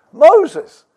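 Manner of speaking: high, loud proclaiming of the name; exclaimed name. Real, not acted. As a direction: ring the name out to the congregation.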